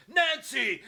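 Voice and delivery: high, loud shout of her name; hammed-up husband's yell; mock alarmed cry. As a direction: call out in a panic for your wife. Husband calls out to wife.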